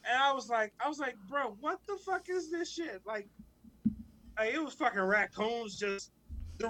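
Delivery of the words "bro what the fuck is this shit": higher-pitched, indignant disbelief; appalled disbelief. Retold or imitated. Retelling arrival at the shabby housing, appalled.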